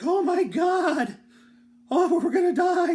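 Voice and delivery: high, loud, slow panicked cry; mock terror. Boy terrified by snakes shrieking that they'll die. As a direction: shriek it like you're sure you'll die.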